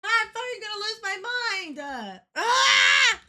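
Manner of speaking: high anguished cry; frustrated wail. Real, not acted. Streamer losing points, wailing in frustration. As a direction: wail in despair.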